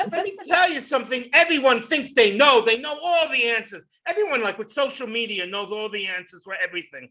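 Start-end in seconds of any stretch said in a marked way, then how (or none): none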